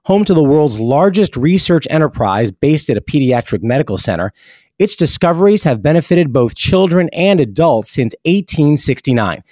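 even, polished ad read; host switching into a hospital sponsor announcement. Acted.

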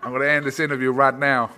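loud, laughing cowboy-like American twang; badly faked cowboy American; mock American accent. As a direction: parody an American through laughter. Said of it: "You sound like a cowboy".

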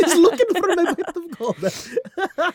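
put-on high, slow laughing leprechaun accent on "She's looking for my pot of gold"; mock leprechaun accent. Host mimics friend's leprechaun accent line, laughing. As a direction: squeak it out like a cheeky leprechaun accent.

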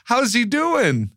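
high, slow, gushing delight; light up at old news of a friend; excited. Retold or imitated. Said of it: "I go".

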